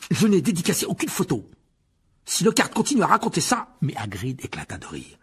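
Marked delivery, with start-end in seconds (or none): 0.1-3.7 furious. A narrator's reading. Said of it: "Furieux, Harry parvint à décoller ses mâchoires".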